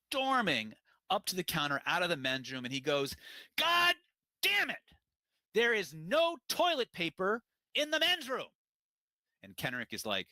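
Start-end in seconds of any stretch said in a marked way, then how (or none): 3.6-8.5 furious yell, much higher and louder, slowing at the end; hammed-up enraged gym giant; angry bodybuilder shout. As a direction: storm up to the counter bellowing.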